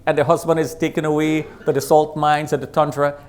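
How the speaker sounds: normal talking voice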